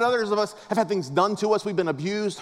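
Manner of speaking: usual speaking voice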